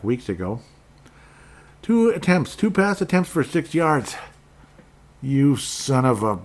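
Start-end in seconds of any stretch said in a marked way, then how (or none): 1.8-4.2 high, loud outraged stat reading; can't believe these numbers; real fantasy-owner outrage; outraged disbelief. Real, not acted. Outraged at quarterback stats. 5.2-6.4 cursing. Real, not acted. Cursing again at quarterback.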